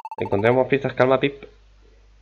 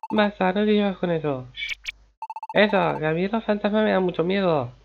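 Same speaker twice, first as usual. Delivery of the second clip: put-on scared kid; 7 semitones higher than usual. Scared kid begging to stop.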